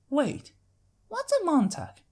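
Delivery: put-on high curious little-girl voice; curious filly. Apple Bloom puzzled about the Montag, high curious filly. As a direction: ask wide-eyed like a small filly.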